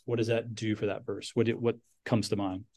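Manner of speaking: usual speaking voice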